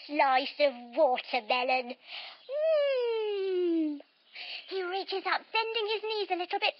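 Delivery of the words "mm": a high delighted "mm"; yummy mm. Acted. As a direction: hum over something tasty. Savoring food in story.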